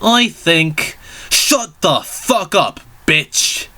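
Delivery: high, slow mock kid voice; high throat-cleared Luke voice. Luke rudely answers the professor, high and slow. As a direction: draw out the insult in a squeaky high voice. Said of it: "Luke cleared his throat".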